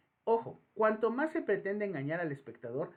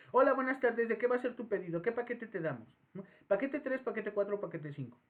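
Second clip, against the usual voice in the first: brisk, higher cashier greeting; fast-food cashier; 5 semitones higher than usual. Retold or imitated. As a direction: rattle off the menu options. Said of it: "Incluso lo hacen de manera más rápida".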